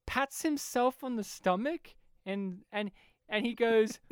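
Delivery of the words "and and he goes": ordinary delivery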